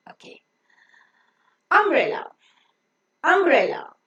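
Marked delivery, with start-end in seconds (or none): none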